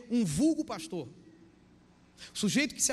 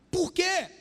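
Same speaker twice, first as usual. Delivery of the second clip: high, loud demand; sudden raised-voice rhetorical challenge; emphatic question; 10 semitones higher, 5 dB louder than usual. Real, not acted. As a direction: fire the question out at the congregation. Preacher throws question at congregation.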